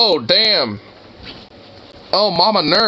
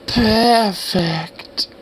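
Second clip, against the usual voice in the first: higher, put-on echo; mimicking video woman; 5 semitones higher than usual. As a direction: parrot her catchword in an affected voice. Mimics woman in played clip.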